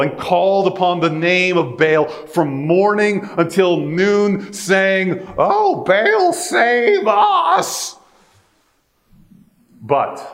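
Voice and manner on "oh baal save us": much higher, wailing mock plea; pleading prophets. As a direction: cry out desperately to a silent idol.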